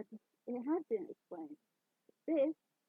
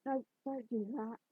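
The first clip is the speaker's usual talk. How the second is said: usual speaking voice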